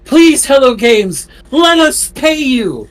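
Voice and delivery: high, drawn-out mock plea; slow, high-pitched hammed-up begging. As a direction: plead like a desperate crowd. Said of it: "look up at me and beg".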